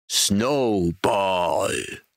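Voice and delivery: drawn-out, restrained mock roar; thunderous roar. A narrator's reading. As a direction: stretch the name into an understated thundering cry. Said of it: "brüllte er plötzlich mit Donnerstimme".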